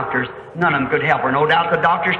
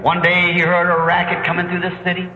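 normal talking voice

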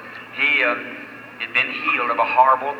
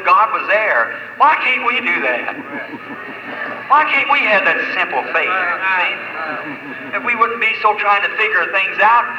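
rising pulpit fervor; fervent preaching; 5 semitones higher than usual. Real, not acted. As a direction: preach it out to the congregation. Preaching climax on simple faith.

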